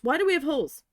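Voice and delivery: imitating an irate manager shouting; mock angry manager. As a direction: play the furious boss yelling. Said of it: "flipping out at me".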